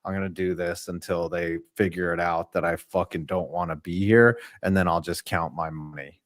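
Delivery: low, quiet, checked-out voice; mock a player who doesn't care, low and subdued; imitating uninterested player. Host voicing a player who hates football, low and uninterested. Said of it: "looks like a guy that just doesn't even like being".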